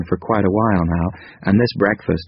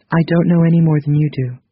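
higher, gentle female character voice; 9 semitones higher, 4 dB louder than usual. A narrator's reading. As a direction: voice Krita Kano lightly higher. Krita Kano replies.

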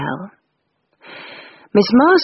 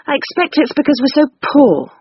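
put-on higher girlish voice, bitter and sulky; bitter younger sister, voiced higher; bitter Rose; 8 semitones higher than usual. A narrator's reading. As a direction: let the bitterness seep through, pitched up. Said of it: "said Rose bitterly".

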